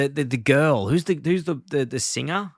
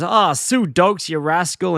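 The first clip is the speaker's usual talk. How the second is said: high, drawn-out cartoon hello; raised, louder, jovial put-on welcome; Flanders' cheery greeting; 6 semitones higher, 4 dB louder, slower than usual. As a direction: greet a neighbour with mock delight. Flanders greets zombie neighbour at door.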